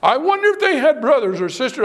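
ordinary talk, nothing marked

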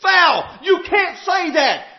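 loud, indignant hollering of foul; preacher imitating an angry crowd crying foul; mock outcry. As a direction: yell it like a scandalised crowd. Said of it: "an outcry that hollered".

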